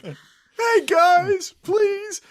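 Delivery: very high, whiny begging; mock desperate plea. Mocking Cody pleading with fans, very high. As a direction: plead pathetically with the crowd.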